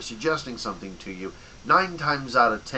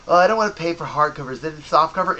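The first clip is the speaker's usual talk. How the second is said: mock whiny customer; 5 semitones higher, 6 dB louder, faster than usual. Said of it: "They go".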